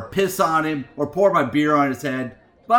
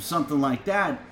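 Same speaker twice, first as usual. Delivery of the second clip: ordinary talk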